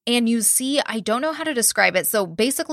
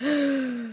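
sharp retold gasp; mock startled gasp; 5 semitones higher, 5 dB quieter than usual. Acting out Dakota hearing his name, mock gasp. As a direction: suck in air, imitating a spooked man.

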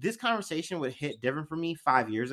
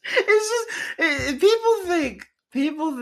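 high, loud laughing speech; can't stop laughing mid-sentence; laughing through words; 12 semitones higher, 6 dB louder, slower than usual. Real, not acted. Laughing while explaining to friend, high and loud.

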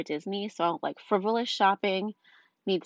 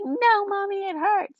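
put-on very high, loud little-kid wail; put-on upset child voice, pitched way up; imitating upset toddler; 13 semitones higher, 5 dB louder than usual. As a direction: mimic a three-year-old protesting after a tumble. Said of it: "she's all upset".

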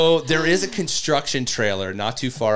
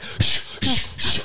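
high whooshing shushes for running; imitated running noise; 13 semitones higher, slower than usual. Mimicking how his running sounded on the air, whooshing. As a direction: shush out each running stride. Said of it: "running on the air".

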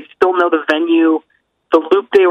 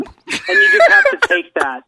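very high, slow, laughing delivery; slower than usual. Real, not acted. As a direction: let laughter stretch the words. Laughs at Sam's rigid rules.

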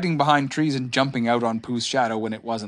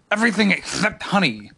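firm creature voice; final verdict in a put-on animal voice; decided Tigger; 6 semitones higher than usual. A narrator's reading. Tigger rejects the honey at breakfast, very decided. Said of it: "in a very decided voice".

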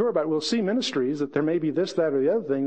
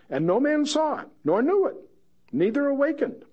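much higher, marvelling read; hushed wonder reading; 10 semitones higher than usual. Acted. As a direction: marvel at each phrase. Reading, pitch jump.

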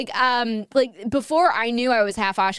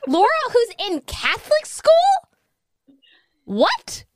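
high, incredulous questioning; retold shocked disbelief; 13 semitones higher, 4 dB louder than usual. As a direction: act out a friend's utter bafflement.

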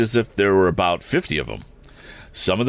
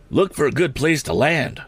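higher-pitched urgent instruction; mildly cried out; 8 semitones higher than usual. A narrator's reading. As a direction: call it out higher, without real shouting. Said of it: "cried Mister Sharp to Tom".

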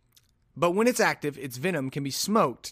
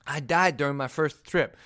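lightly lisped boxer voice; rough impression of a lisping boxer; Mike Tyson. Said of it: "read this in my Mike Tyson voice".

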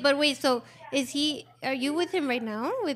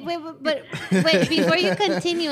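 laughing; 3 semitones higher, 4 dB louder than usual. Real, not acted. Hosts laughing at caller's story.

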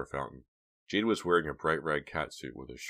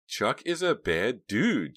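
plain reading voice

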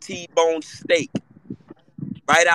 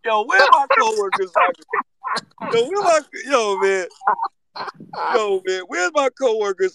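calling out; 10 semitones higher than usual. Real, not acted. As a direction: shout for the coworkers. Calling out for coworkers.

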